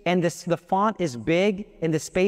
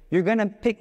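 usual speaking voice

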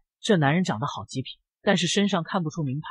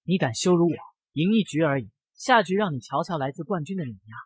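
ordinary delivery, nothing marked

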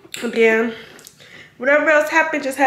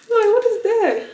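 surprised laugh; 9 semitones higher than usual. Real, not acted. Laughs at the shot.

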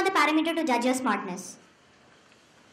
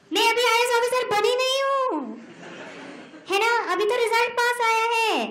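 higher, faster, disbelieving protest; can't believe they'd want that; incredulous exasperation; 7 semitones higher, much faster than usual. Real, not acted. Incredulous at fans wanting copy.

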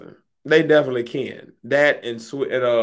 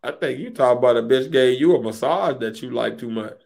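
ordinary talk

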